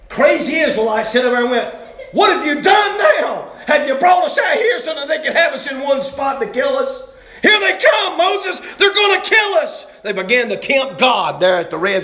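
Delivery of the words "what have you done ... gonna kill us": panicked shouting. Acted. Trapped Israelites shouting at Moses in panic. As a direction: yell at Moses as the army closes in.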